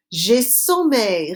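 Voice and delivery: J'ai sommeil said slowly and clearly; slow model phrase. Shown as a demonstration. Teacher slowly modelling a phrase for the class. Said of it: "Répétez".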